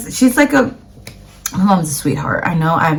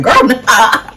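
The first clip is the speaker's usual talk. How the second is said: laughing; 5 semitones higher, 4 dB louder than usual. Real, not acted. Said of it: "reacting to first class prices".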